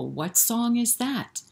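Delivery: normal talking voice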